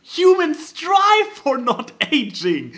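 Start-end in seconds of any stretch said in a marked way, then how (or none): none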